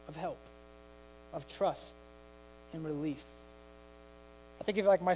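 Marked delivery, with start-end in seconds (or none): none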